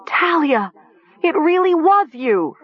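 high, horrified scream. A narrator's reading. Nessa realises Talia is the killer, screaming. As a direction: cry the accusation at a high pitch. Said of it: "she heard Nessa scream".

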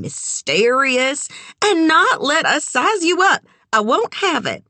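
high-pitched, playful insistence; insistent small-town woman. A narrator's reading. Sugar pressures the newcomer to join them, high and insistent. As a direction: pitch it up and refuse to take no.

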